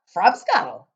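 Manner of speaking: booming, slow giant with odd grammar; loud giant voice. Acted. Giant questioning the girl, booming and puzzled.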